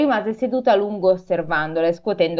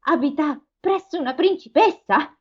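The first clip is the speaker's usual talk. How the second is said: put-on young Gerda asking; 7 semitones higher than usual. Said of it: "chiese Gerda".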